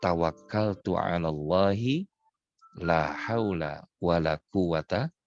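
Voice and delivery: slow, drawn-out recitation to follow along; slow recited prayer. Shown as a demonstration. Preacher leading the congregation in the travel prayer, slow recitation. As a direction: recite each phrase slowly for the listeners to repeat. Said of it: "Yuk ikutin bareng-bareng".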